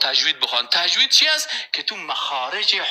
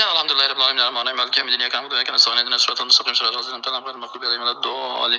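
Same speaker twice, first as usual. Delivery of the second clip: racing through the Fatiha in one breath; rushed recitation; 3 semitones lower, much faster than usual. Shown as a demonstration. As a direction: gabble it fast like a hurried worshipper. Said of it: "بگو که چند نماز تو ترکات کن".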